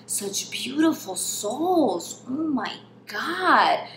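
slow, breathy whisper of awe; admiring whisper. Retold or imitated. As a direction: whisper it slowly, marvelling at the feet. Imitating Ludwig admiring her feet.

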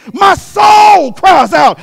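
loud, slow, drawn-out cry; preacher's cry. Acted. Preacher crying out to the congregation, loud and slow. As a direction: stretch it out and lift the church. Sermon build.

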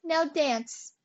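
loud, high scornful dismissal; disgusted. A narrator's reading. Ants turning away the hungry grasshopper, scornful. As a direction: dismiss them forcefully with scorn. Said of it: "shrugged their shoulders in disgust".

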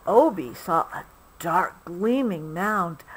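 plain reading voice

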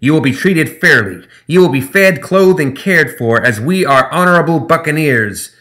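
high, loud, grandiose gremlin speech; pompous gremlin captain. Acted. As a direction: declare terms like an honorable buccaneer. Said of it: "Zip said".

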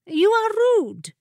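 very high-pitched put-on protest; indignant carpet. A narrator's reading. Carpet scolds the familiar who bit it, high and indignant. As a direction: pitch it way up, affronted.

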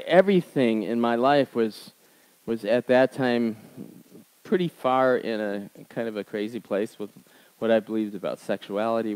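ordinary talk

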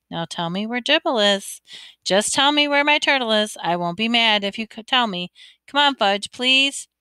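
ordinary delivery

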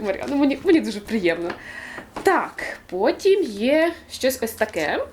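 ordinary talk

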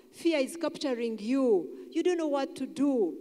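ordinary talk

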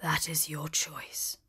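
low, slow, soft whisper; the beast accepts Belle's refusal, low, sad and whispered. A narrator's reading. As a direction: let it sink in quiet sorrow. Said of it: "said the beast sadly".

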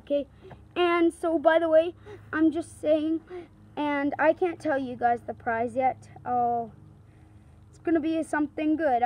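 ordinary talk, nothing marked

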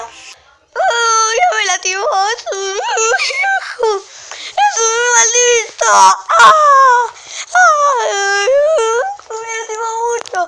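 high, drawn-out fake crying; mock wailing. Hurt [name] complains to Ian, hammed-up loud crying. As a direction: wail loudly like a hurt cartoon victim.

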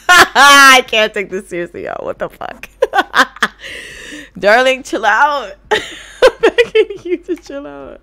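loud, high, laughing pleas; can barely talk for laughing; cracking up at the screen; laughing hard. Real, not acted. Cracking up at sim.

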